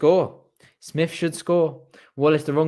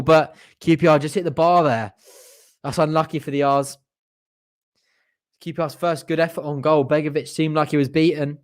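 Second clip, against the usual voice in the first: usual speaking voice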